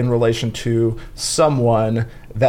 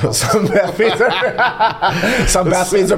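laughing through the words, pitch high; can't keep a straight face, giggling it out; 5 semitones higher than usual. Real, not acted. Laughing at a cohost's joke about bath beads, giggling.

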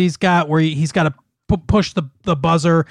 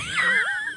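high giggle; 7 dB quieter than usual. Real, not acted. Laughs at circumcision joke.

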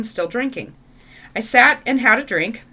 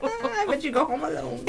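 laughing tease; 4 semitones higher than usual. Real, not acted. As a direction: tease while trying not to crack up. Laughing along with co-host's joke.

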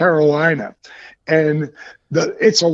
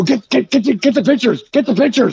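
higher, repeated hurried command; urgent urging; 5 semitones higher, faster than usual. Retold or imitated. Driver urging passengers to grab pictures, acted urgency. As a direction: rattle it out in a mock scramble.

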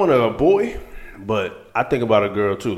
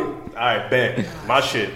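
ordinary talk, nothing marked

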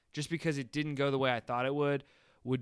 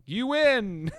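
mock triumphant; 9 semitones higher, 6 dB louder than usual.

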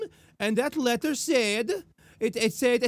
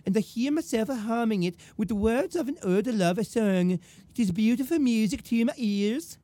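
hammed-up accented love-letter voice; mock-romantic woman with lilting phrase ends; Belle. Woman wooing her suitor, hammed-up romantic accent. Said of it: "I'll read it in Belle's voice".

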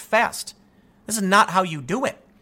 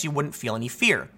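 usual speaking voice

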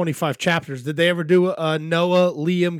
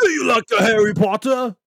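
loud, higher put-on British voice; mock British accent; 5 semitones higher, 5 dB louder than usual. British character quizzing co-host on Harry Potter, loud mock accent. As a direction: call it out in a broad British accent. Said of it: "'cause it's British, right?".

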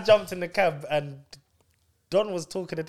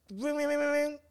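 high sung ring ring; phone ring imitation; 10 semitones higher, 6 dB quieter than usual.

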